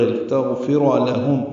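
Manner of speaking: لتغفر لہم slowly, with the لام kept quick; tajweed demonstration. Shown as a demonstration. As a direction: recite لتغفر لہم in careful slow syllables. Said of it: "لام کو جلدی پڑھیں گے،". (لتغفر لہم آہستہ تجوید کے ساتھ)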